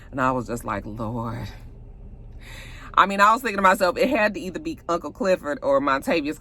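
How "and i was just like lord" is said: low-pitched, subdued reaction; retold dread. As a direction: let it drop as a grim aside. Own dread at seeing blood.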